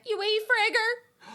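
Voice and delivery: put-on high, loud, slow little-child voice. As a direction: squeak it out like a toddler. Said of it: "and I went".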